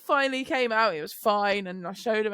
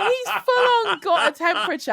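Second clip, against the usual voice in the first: higher, laughing indignation; amused disbelief; 9 semitones higher, 6 dB louder than usual. Real, not acted. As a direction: laugh while insisting. Laughing about son's denial.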